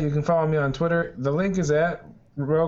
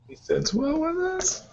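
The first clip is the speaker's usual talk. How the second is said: high, slow punny delivery; 12 semitones higher, slower than usual. Pretend. Pun joke.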